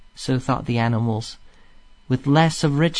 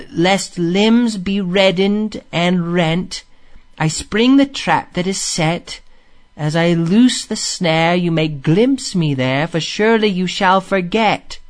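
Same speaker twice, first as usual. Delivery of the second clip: slow, raised, measured chant-like delivery; incantatory verse recital; 5 semitones higher, slower than usual. A narrator's reading. Rat relays the song's words to Mole, incantatory. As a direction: recite it as a mystic voice heard in music.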